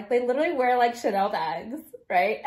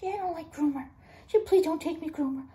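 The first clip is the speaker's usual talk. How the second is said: dog's voice; 6 semitones higher, 4 dB quieter than usual. Pretend. Voicing the dog's reply.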